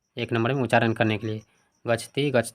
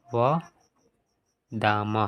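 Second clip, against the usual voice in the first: slow dictation; much slower than usual. Shown as a demonstration. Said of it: "यहाँ पे हो जाएगा".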